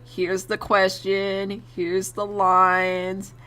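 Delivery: very slow, stretched-out listing; drawn-out recital. Retold or imitated. Teacher setting out the homework sheet, slow drawn-out listing. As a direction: draw out each item of the list. Acting out worksheet handed over.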